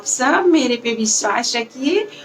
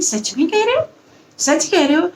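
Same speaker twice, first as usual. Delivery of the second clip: alarmed question; 6 semitones higher than usual. Acted. Villagers startled by news.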